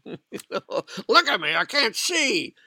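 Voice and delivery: high, slow, put-upon voice of the blind man; exasperated blind man. Retold or imitated. Preacher voicing the blind man to Jesus, exasperated. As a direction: play the blind man, amazed he must explain.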